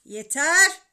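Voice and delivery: loud fatherly outburst; exasperated father yelling; angry shout. A narrator's reading. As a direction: shout it to cut them off. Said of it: "diye bağırdı babam".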